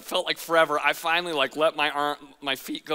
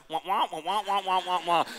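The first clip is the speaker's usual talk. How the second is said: muffled trombone wah wah; Charlie Brown teacher. Retold or imitated. Lifeguard's instructions heard as muffled Charlie Brown teacher wah wah. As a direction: mimic the muted teacher noise. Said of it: "it was like Charlie Brown teacher".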